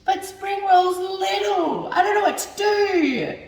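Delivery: high, slow despairing whine; whiny frustration. Real, not acted. Frustrated whine over failed wraps.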